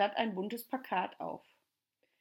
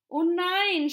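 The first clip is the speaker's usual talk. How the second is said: loud, high cry of dismay; horrified cry; 10 semitones higher, 8 dB louder than usual. Acted. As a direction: cry out in shocked disappointment. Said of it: "schrie Tommy entsetzt".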